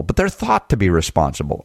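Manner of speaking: normal talking voice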